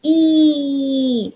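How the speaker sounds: "E" held out long and high; long E sound. Shown as a demonstration. Teacher showing off a favourite vowel, long held E. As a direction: sustain a high, drawn-out "eee". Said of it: "and it goes E".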